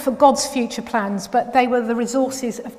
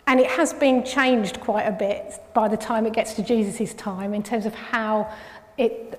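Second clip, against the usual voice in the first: normal talking voice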